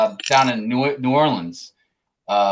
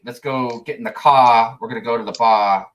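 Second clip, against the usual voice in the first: Massachusetts accent. Shown as a demonstration. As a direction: imitate Massachusetts locals dropping their r's. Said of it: "have an accent where they say".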